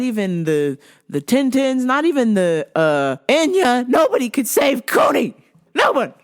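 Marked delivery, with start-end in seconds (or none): 3.9-6.1 higher, emphatic cry of despair; dramatic lament. Real, not acted. Fan lamenting the fighter's loss, dramatic cry. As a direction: proclaim it high and emphatic.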